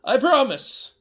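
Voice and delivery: a hint of a stunned grandson; stammered thanks as the pretend grandson; young man's voice. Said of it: "Mister Hayworth said".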